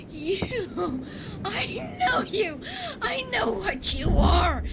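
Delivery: mocking hysterical accusation; hysterical cackle. A narrator's reading. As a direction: cackle at him, half mad. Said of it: "she cackled".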